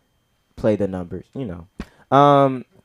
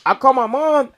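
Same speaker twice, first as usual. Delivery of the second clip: normal talking voice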